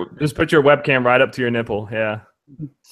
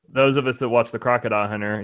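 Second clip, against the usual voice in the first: ordinary delivery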